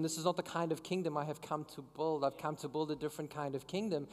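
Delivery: soft, hushed and calm, a quiet rebuke; Jesus speaking gently. Retold or imitated. Jesus gently correcting Peter, soft and quiet. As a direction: lay it down softly, like a patient teacher.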